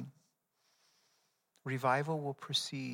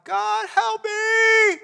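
very high, loud, slow panicked shout; desperate cry for help; 11 dB louder, much slower than usual. Acted. Climber dangling from a cliff cries to God, very high panicked shout. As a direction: scream it up to heaven, hanging on for life. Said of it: "He cried out to God".

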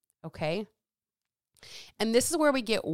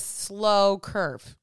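slow, drawn-out. Shown as a demonstration. Draws out slow to illustrate.

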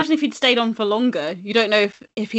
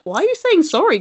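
high-pitched incredulous question; lightly acted puzzlement, pitched up; mock disbelief; 7 semitones higher than usual. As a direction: question it in exaggerated bafflement. Acted challenge to people apologising.

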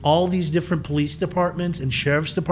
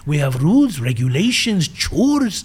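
Persian Yoda voice; 2 semitones higher than usual. Retold or imitated. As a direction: play a wise little Persian Yoda. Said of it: "he sounded like Yoda with a Persian accent".

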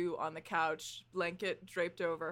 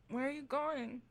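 sleepy half-awake call; 6 semitones higher, 5 dB quieter than usual. Acted. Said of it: "and you hear".

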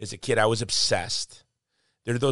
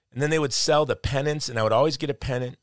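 normal talking voice

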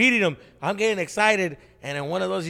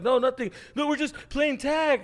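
put-on innocent kid; 10 semitones higher than usual. Kids covering to adults.

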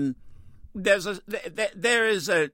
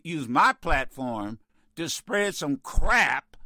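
ordinary delivery

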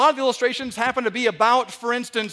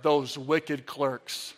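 sarcastic aside; 7 semitones lower, 4 dB quieter, much slower than usual. Real, not acted.

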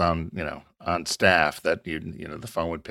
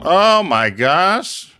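high, loud, slow oh my gosh; shocked recognition of Miss Cleo; delighted gasp; 11 semitones higher, 9 dB louder, much slower than usual. Real, not acted. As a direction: stretch it out in loud surprised delight. Reacting to Miss Cleo reveal.

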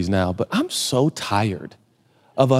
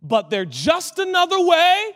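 slow, raised, weighty scripture delivery; dramatic preaching; 10 semitones higher, slower than usual. Real, not acted. Preacher pressing a verse on his congregation, slow and dramatic. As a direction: stretch each phrase out for the congregation.

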